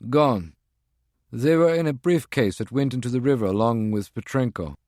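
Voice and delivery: a tense field agent reporting in; agent's voice. Acted. Voices Prague One on phone.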